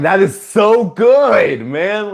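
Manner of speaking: high, loud and slow replay of his own cheer; excited shout. Retold or imitated. Fan retelling his reaction to the Goblin, excited shout. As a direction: relive the thrill out loud. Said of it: "Like, I was like".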